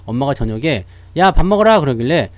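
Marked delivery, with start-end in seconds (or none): 1.2-1.8 a mom's loud call to come eat; mother calling her sulking kid to eat; mom calling to dinner. Retold or imitated. Mother calling her sulking son to dinner, loud.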